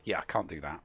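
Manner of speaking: low, soft admission; giving up. Real, not acted. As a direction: back down quietly. Gives up after the shout.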